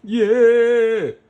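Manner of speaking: high, loud yay; cheering. Acted. Cheering tiger win.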